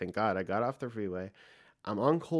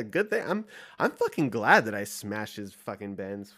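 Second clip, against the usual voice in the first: ordinary delivery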